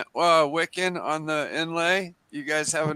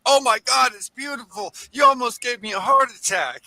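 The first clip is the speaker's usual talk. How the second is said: amazed exclaim; 7 semitones higher, 3 dB louder than usual. Retold or imitated. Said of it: "and he goes".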